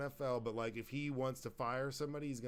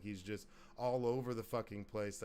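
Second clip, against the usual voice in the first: ordinary talk, nothing marked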